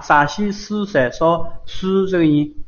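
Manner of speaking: slow model. Shown as a demonstration. Teacher slowly lists shi sounds for the student. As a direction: slowly spell out 陕西狮色说诗. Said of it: "我们沙哈".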